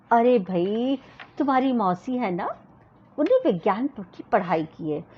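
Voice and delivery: ordinary delivery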